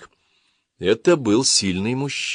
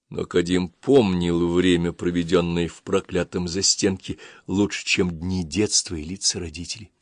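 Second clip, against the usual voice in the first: ordinary delivery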